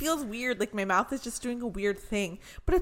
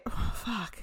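ordinary talk, nothing marked

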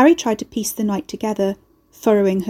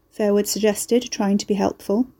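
ordinary delivery, nothing marked

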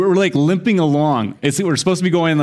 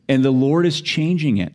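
ordinary delivery

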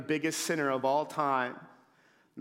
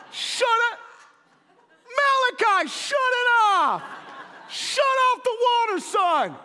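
shocked yell; 7 dB louder than usual. Retold or imitated. Finds son flooding bathroom.

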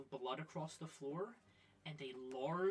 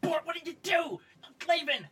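high, loud angry creature shout; angry creature yell; 12 semitones higher, 12 dB louder than usual. Acted. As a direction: yell at your clumsy partner. Creature yelling at its partner.

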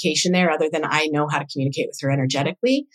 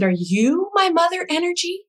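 astonished question; 11 semitones higher than usual. Retold or imitated. Asks the vision in disbelief.

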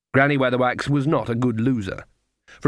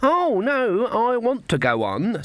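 put-on high, anxious old-lady protest; anxious grin; 11 semitones higher, slower than usual. A narrator's reading. Granny begs sharpers to keep playing, anxiously. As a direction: plead to continue, pitched right up. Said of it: "grinning anxiously".

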